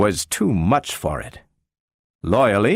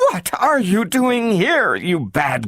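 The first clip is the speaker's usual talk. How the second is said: higher, slow scolding mother's voice; 10 semitones higher, slower than usual. A narrator's reading. Gloria's mother scolds her for running off, slow and high. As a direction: tell off a runaway child with relief.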